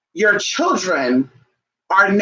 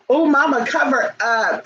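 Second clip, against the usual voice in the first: higher, slow mock scold; mock disapproving child; 7 semitones higher than usual. Child scolding mama's outfit, a hammed-up mock scold. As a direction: mimic a kid telling mom to cover up. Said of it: "some people get offended".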